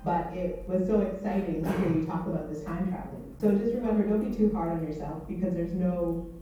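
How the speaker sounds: ordinary delivery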